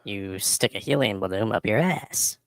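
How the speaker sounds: usual speaking voice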